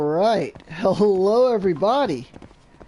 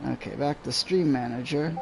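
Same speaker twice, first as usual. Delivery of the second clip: ordinary talk, nothing marked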